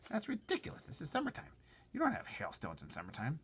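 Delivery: high scoffing centipede. Acted. Centipede scoffing at James, high. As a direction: pitch up and scoff. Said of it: "the centipede said".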